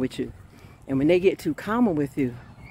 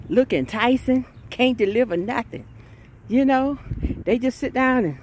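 ordinary delivery

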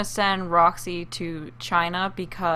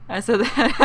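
laughing; 7 semitones higher, 6 dB louder than usual. Real, not acted. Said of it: "I don't know why I'm laughing so hard".

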